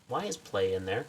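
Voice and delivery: ordinary delivery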